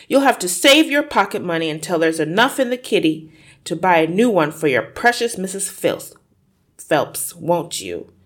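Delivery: loud, fast taunting; mocking father. Father mocking his daughter while tearing the book.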